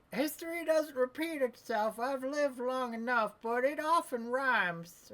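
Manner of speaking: put-on high, slow old-timer drawl; old man voice. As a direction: play a folksy old man.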